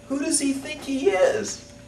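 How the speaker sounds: very high, slow, stretched-out indignation; the over-the-top take; outraged. Acted. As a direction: drag it out in disbelief. Repeat of the rant, bigger.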